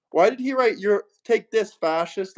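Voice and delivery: usual speaking voice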